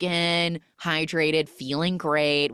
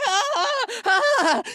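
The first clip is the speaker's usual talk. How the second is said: high scream; 4 dB louder than usual. Retold or imitated. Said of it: "is like".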